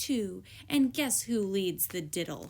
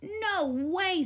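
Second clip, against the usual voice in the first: scoffing; 7 semitones higher, 3 dB louder than usual. A narrator's reading. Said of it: "said Honey Bun".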